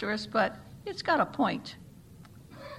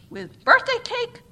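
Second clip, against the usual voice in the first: much higher, loud slow shriek of disbelief; screamed; 13 semitones higher, 10 dB louder, slower than usual. A narrator's reading. The chairperson screams at the offer of birthday-cake communion. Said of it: "screamed the chairperson".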